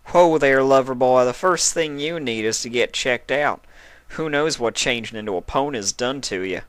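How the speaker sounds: lightly voiced country mare reining him in; firm but teasing; teasing Applejack. A narrator's reading. Applejack stopping Spike, light country tease.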